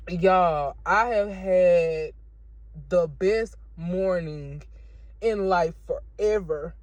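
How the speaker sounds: slow, drawn-out, every word weighted; slow, moved declaration; slow emphatic testimony. Real, not acted. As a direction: savour each word slowly. Joyful testimony.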